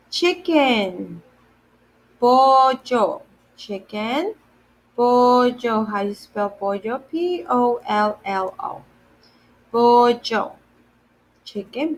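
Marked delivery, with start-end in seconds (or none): none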